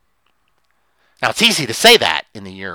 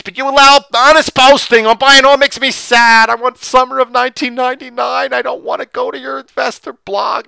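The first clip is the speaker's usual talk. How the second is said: high-pitched mock whining reader; hammed-up plaintive protest; mock complaining; 11 semitones higher, 6 dB louder than usual. Acted complaint of blog readers.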